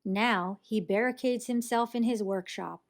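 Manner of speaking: plain reading voice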